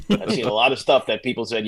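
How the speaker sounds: usual speaking voice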